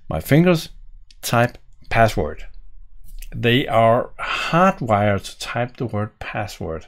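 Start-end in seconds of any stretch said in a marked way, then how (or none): none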